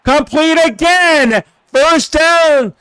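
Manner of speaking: high, stretched-out big call; excited play-by-play. Real, not acted. Streamer calling Tony Rice's first down, excited play-by-play.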